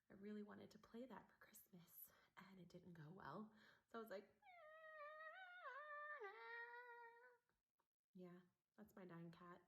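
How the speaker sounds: softly whispered confession; whispering. Real, not acted. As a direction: whisper it under your breath.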